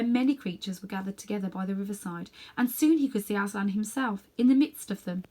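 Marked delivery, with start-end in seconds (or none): none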